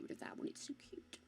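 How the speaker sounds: breathy whispered aside. Real, not acted. Streamer whispers to herself about her cute battery pack, whispered. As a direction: whisper it to yourself, fond.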